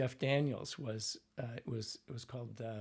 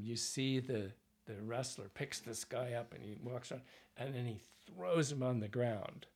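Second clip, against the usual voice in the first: ordinary delivery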